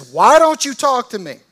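loud, high, exasperated shout of "Why don't you talk to me?"; a governor losing his temper, shouting at a silent prisoner; frustrated outburst. Retold or imitated. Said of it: "He gets frustrated and he says".

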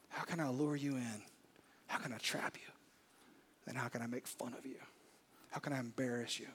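a hushed, sly devil plotting; scheming devil. Acted. Satan plotting against the listener, hushed and sly. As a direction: soften to a sly whisper of a schemer.

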